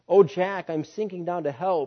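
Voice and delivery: high anguished plea; crying in agony. Acted. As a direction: beg from the floor in terror.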